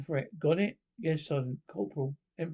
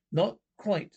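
ordinary delivery

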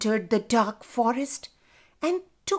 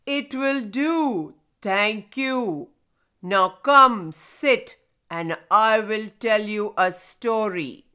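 grinding rumbling rock voice; 4 dB louder than usual. Acted. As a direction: rumble it out slow and big like old rock. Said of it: "Grandfather Stone said".

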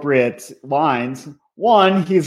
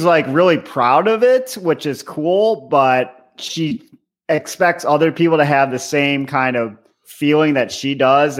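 usual speaking voice

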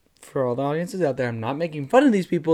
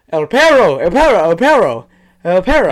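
loud, higher, insistent chant; frantic repeating; 4 semitones higher, 12 dB louder than usual. Retold or imitated. Trying Spanish on a cleaner, loud and desperate. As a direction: blurt the one foreign word over and over.

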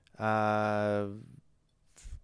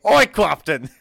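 loud, high shout of Oi, Captain!; shouted call; 6 semitones higher, 13 dB louder than usual. Acted. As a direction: bellow Oi, Captain! across the deck. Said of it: "Oh, yeah, oi!".

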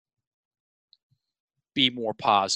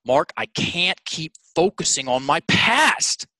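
higher, slow, heated retold complaint; emphatic, emotional outburst; 5 semitones higher, 3 dB louder than usual. Stuck young client venting to his coach, very emphatic and emotional. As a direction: say it with exasperated emphasis, climbing on the last word. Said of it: "very emphatically, very emotionally".

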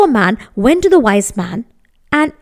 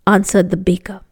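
usual speaking voice

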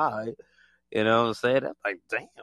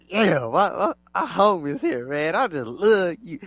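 mock hype greeting; 5 semitones higher, 4 dB louder than usual. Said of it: "they just like".

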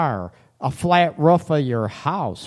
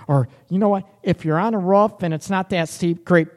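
ordinary delivery

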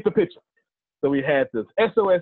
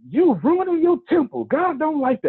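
high, scolding churchy grandma; scolding grandmother; 14 semitones higher than usual. Retold or imitated. Grandmother scolding her grandson over his tattoo, high and stern.

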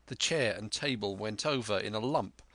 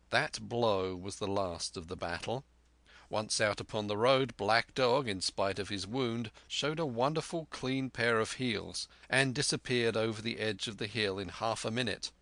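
usual speaking voice

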